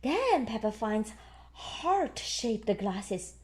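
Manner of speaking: plain reading voice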